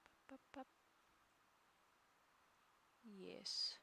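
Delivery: usual speaking voice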